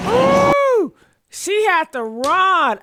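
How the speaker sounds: high, loud and slow, savouring it; delighted shriek at the clip; gleeful squeal. Real, not acted. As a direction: squeal and gloat over it. Reacting to her running.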